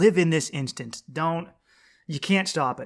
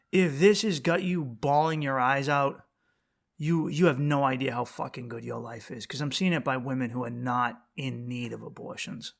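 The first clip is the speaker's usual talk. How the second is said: ordinary delivery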